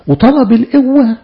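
high, loud and slow; fervent preacher's cry; preaching climax. Real, not acted. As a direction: build it to a ringing peak. Climax of the sermon point.

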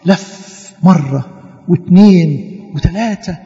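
slow, drawn-out count; slow lap counting. Retold or imitated. Teacher counts the laps round the wall, slow counting. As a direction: stretch each lap out slowly.

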